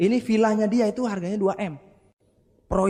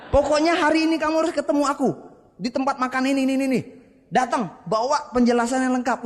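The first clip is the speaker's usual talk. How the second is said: insistent friend; 7 semitones higher, faster than usual. Retold or imitated. Saleh demands a meeting.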